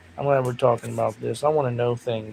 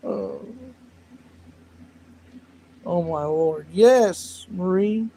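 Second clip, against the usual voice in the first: ordinary talk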